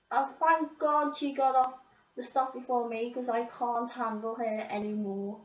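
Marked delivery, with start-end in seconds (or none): none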